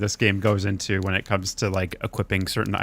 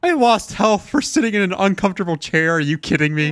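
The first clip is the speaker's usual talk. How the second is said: outraged disbelief; 9 semitones higher, 3 dB louder than usual. Real, not acted. Outraged at losing health to chair.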